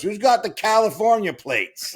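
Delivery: high, loud, slow holler; like a movie local demanding an answer from everyone; calling out to the room. Retold or imitated. Stranger calls out across restaurant.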